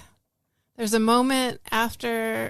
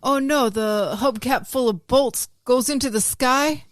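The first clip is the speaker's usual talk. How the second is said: mock alarm; 6 dB louder than usual. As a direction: ham up the disaster. Mock-dramatic sound effect bit.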